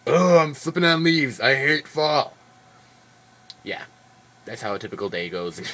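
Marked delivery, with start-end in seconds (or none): 0.1-2.4 louder, higher mock whine about snow; mock whiny complaint about winter; mock complaining. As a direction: whine it like a grumpy Wisconsinite. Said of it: "by golly".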